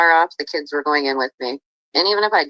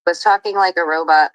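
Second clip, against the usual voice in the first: normal talking voice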